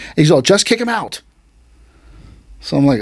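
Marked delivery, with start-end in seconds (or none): 0.4-1.2 higher-pitched dismissive sensei; brusque retold order. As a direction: toss it off as an impatient order. Retold sensei dismissing student's concern.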